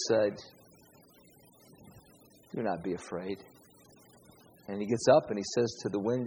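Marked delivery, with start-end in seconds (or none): 2.6-3.4 softly, calming frightened men; Jesus calming his disciples in the storm, quiet and gentle; quiet reassurance. Acted.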